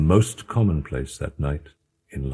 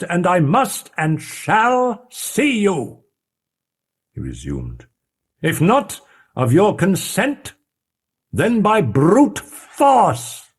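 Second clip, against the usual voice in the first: stern demand; 13 semitones higher, 3 dB louder than usual. Acted. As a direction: warn him gravely, each word weighed. Utterson threatens through the door.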